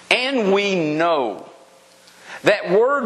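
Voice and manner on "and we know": slow, deliberate verse reading. Shown as a demonstration. Preacher reading a verse to the congregation, slow and deliberate. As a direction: read it out slowly, drawing out each word. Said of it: "Let me read it again slowly".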